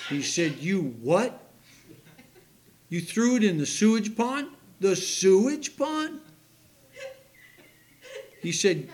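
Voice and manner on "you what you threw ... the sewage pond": louder, rising incredulous dad; mock astonished father, going louder and higher; retold disbelief. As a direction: act out the father's shock at the sewage pond.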